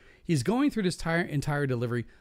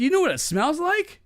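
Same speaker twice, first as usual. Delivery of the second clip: cheeky comeback; 6 dB louder than usual. Retold or imitated. Snarky comeback to the coworker.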